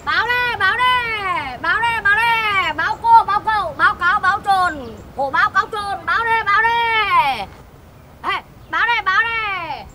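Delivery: newspaper seller's street cry. Acted. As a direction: call out the papers down the street. Người bán báo rao hàng. (tiếng rao bán báo kéo dài)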